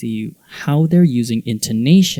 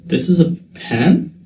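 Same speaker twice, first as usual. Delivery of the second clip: higher voice, long rise on PEN; questioning intonation; 4 semitones higher than usual. Shown as a demonstration. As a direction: stretch PEN into a rising question. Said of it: "some examples that I thought were fun".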